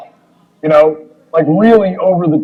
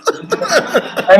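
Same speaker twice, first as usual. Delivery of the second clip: laughing protest; 7 semitones higher than usual. Retold or imitated.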